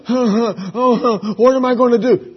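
high, loud, huffing fretting; mock panic. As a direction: flail helplessly, hammed-up worry. Acts out someone clinging to worry.

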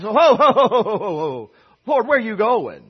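loud hammed-up chuckle, then the question; mock laughing question. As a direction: laugh it off like a bemused disciple. Thomas voiced, laughing at the Lord.

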